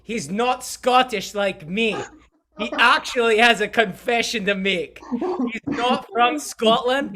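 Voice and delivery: loud, high put-on Scottish accent. Host introducing guest in a mock Scottish accent. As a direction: bold mock Scottish brogue, hamming it up. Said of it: "I can do my Scottish accent".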